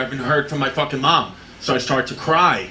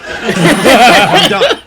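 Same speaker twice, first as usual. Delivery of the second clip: laughing; 6 semitones higher, 9 dB louder than usual. Real, not acted. Laughing at own story.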